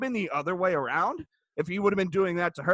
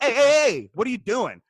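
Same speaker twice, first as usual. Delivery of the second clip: loud, higher-pitched calling out; pretend intervention; 3 semitones higher, 4 dB louder than usual. Imagined husband pulling the wife up short, loud. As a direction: call her off sharply, raised and insistent.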